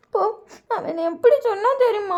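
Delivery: high, slow, whiny voice; tearful complaint. A narrator's reading. Sister complaining to uncle about her brother, tearful whine. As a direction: wail it as an acted grievance. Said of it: "ஏன் வயன் அழுவுற".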